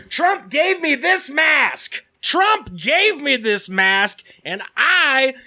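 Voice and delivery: high, slow, shouted rant; imitating a yelling woman. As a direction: mimic a ranting stranger yelling. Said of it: "loudly yelling at everybody".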